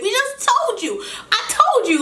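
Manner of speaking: very high squealed protest, half laughing; mock exasperation. Wife retelling repeated explanations to neighbor, mock exasperated. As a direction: whine it high, hammed-up and amused.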